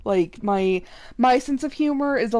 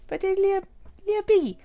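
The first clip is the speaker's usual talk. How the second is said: cooing; 9 semitones higher, much faster than usual. Real, not acted. Gamer cooing at a tiny piggy, tender. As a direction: coo at the little pig.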